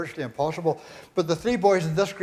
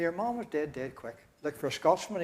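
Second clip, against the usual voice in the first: ordinary delivery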